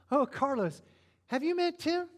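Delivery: high breathless gushing after a gasp; a lovestruck girl gasping over her crush; high swooning "Oh, Carlos"; giddy gushing. Retold or imitated. Wife gushing on meeting him.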